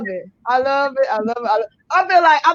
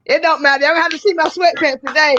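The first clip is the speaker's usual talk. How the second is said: ordinary talk, nothing marked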